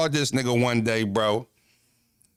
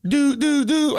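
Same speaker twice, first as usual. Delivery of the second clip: 1 mock dial tone; 14 semitones higher, 5 dB louder, slower than usual. Mock hang-up tone.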